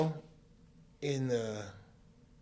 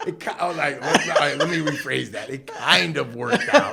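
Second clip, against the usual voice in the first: laughing through a self-correction, louder; giggling as he takes back his own words; 6 semitones higher, 17 dB louder than usual. Real, not acted. Guy correcting his story to the interviewer, laughing.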